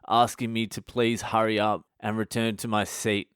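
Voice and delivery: ordinary talk, nothing marked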